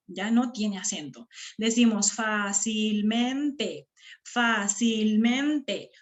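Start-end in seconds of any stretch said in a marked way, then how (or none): 2.1-3.9 fácilmente slowly in syllables, leaning on FÁ and MEN; stressed syllables drawn out. Shown as a demonstration. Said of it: "¿Cuál es la sílaba que suena más?". 4.2-5.9 fácilmente slowly in syllables, leaning on FÁ and MEN; stressed syllables drawn out. Shown as a demonstration. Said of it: "¿Cuál es la sílaba que suena más?".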